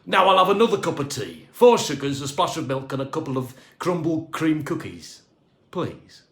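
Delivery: loud, quick, demanding; bossy order. A narrator's reading. Boss ordering the cleaner about, loud and quick. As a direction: rattle off the tea order like a boss.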